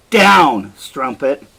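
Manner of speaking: loud barked order of contempt; a husband shoves his begging wife down, furious; brutal command. Acted. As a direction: force her down with your voice. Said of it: "and he says".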